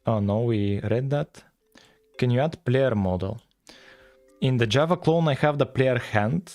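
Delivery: ordinary talk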